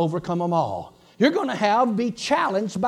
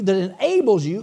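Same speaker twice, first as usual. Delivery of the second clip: ordinary talk, nothing marked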